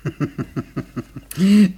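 loud hearty belly laugh; booming laugh. Acted. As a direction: laugh big and pleased with yourself. Butcher boasts to the boy.

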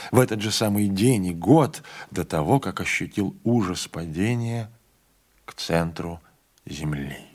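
plain reading voice

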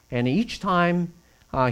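ordinary talk, nothing marked

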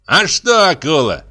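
high-pitched spiteful taunt; snide gloating. A narrator's reading. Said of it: "Михей Егорыч ехидно улыбнулся". (ехидно, высоким голосом)

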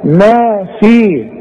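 في drawn out with a long ياء; slow drawn-out vowels. Shown as a demonstration. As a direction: stretch the vowels of ما في slowly. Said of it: "محتاجة ياء".

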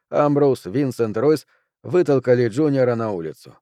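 plain reading voice

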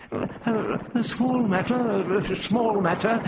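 put-on high-pitched fussy old-man grumble, not hushed; Prapillus, grumbling mutter. A narrator's reading. Prapillus glares at the Doctor's back, grumbling. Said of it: "Prapillus muttered".

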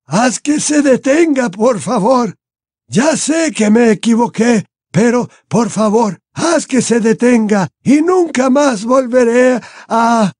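high, drawn-out desperate begging; anguished old man wailing; sobbing plea. A narrator's reading. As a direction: beg tearfully for mercy. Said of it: "sollozó el anciano".

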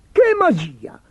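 high, loud burst of excitement; thrilled high-pitched exclamation; excited cry. A narrator's reading. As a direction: cry out high with eager amazement. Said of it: "gridò eccitato l'imperatore".

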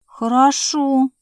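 slow, unsure agreement; hesitant. A narrator's reading. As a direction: drag it out uncertainly. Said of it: "не очень уверенно". (неуверенно, медленно)

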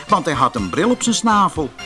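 usual speaking voice

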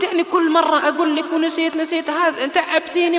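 husband teasing wife with loud fake scolding. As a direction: play-act exasperation at her. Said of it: "رفعت صوتي عليها".

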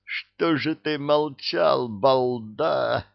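high, slow, drawn-out moan; long-drawn wail of reproach. A narrator's reading. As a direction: moan it in exasperated despair. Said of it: "простонал Арчибальд".

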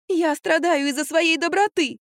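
high, slow self-pitying whine; whimpering. A narrator's reading. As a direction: whimper like a martyr. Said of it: "захныкала Франк". (протяжное жалостливое хныканье)

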